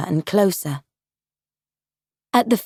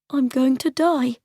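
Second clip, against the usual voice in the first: put-on frightened boy; 6 semitones higher than usual. Boy's frightened thought.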